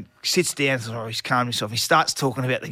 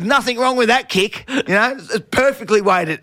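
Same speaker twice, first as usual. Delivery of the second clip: fed-up snap back; 9 semitones higher, 5 dB louder than usual. Retold or imitated. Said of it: "And I've had enough".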